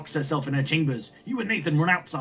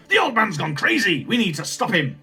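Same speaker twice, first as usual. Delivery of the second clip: loud, raised-pitch alarm; 5 semitones higher, 5 dB louder than usual. Acted. Grandchild overhears mad grandfather, loud and alarmed. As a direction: blurt it out in alarm.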